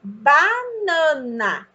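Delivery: BANANA very slow, each syllable held; syllable division. Shown as a demonstration. Child will write the syllables, BANANA stretched syllable by syllable. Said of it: "ela vai escrever as sílabas".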